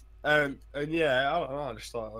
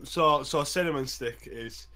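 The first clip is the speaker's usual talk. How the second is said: ordinary delivery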